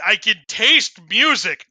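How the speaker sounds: ordinary talk, nothing marked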